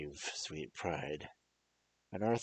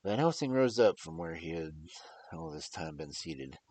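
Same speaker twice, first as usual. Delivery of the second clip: ordinary delivery, nothing marked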